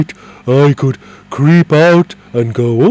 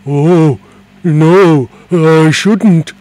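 alarmed; 4 semitones higher, 8 dB louder, much slower than usual. Acted. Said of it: "said the dragon in alarm".

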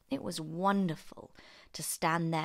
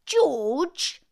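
high, loud call from the next room; from another room; 10 semitones higher, 10 dB louder than usual. Acted. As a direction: yell the name through the wall. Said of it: "came the awful voice from the next room".

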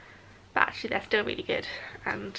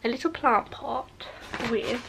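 usual speaking voice

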